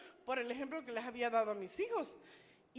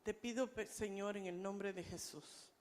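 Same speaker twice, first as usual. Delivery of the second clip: hushed, low-pitched closing prayer; leading prayer; 6 semitones lower than usual. Real, not acted. Leads closing reflection and prayer.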